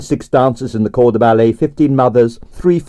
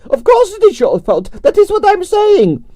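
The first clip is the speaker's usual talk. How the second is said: grande dame Russian ballerina. A narrator's reading. Narrator voicing Arienskaya, imperious and high. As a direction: sigh it out like a tragic prima donna.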